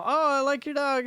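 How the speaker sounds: put-on high, eager kid voice. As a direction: chirp it like a young kid. Voicing passing kids.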